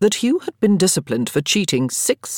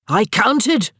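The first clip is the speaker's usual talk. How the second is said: higher, louder gleeful claim; triumphant; 6 semitones higher, 4 dB louder than usual. A narrator's reading. Daniel triumphantly telling friends he counted. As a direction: announce it like a winning point. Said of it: "Daniel said triumphantly".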